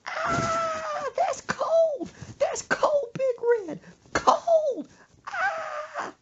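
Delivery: very high, shrieking yelps; mock screaming. Mother drenched with cold soda in bed, mock screaming. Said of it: "And she screams".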